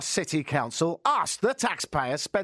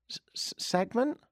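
unsure whisper; 5 semitones higher than usual. Real, not acted. Unsure of the term.

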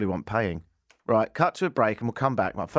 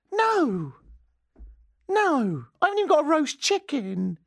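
mock indignant denial. Denying carcasses to police.

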